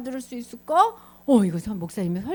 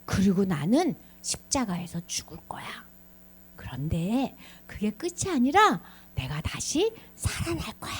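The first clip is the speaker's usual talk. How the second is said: normal talking voice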